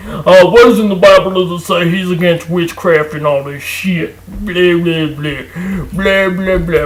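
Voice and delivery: mocking whiny imitation of an opponent. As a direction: parrot them mockingly, then trail into blah. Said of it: "you wanna say".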